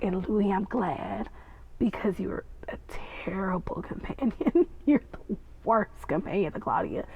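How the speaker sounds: soft, breathy whispered teasing; hushed mock scolding. Real, not acted. Fan whispering a teasing jab at Louis. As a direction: whisper the insult like a secret.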